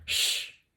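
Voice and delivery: Ch as a soft voiceless hiss; ch sound. Shown as a demonstration. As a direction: hiss the Ch quietly. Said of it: "quand on les colle ensemble". (Ch chuchoté)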